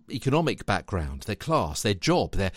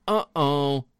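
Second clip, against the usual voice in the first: mock dismay; 5 semitones higher, 4 dB louder than usual. Acted dismay at the mistake.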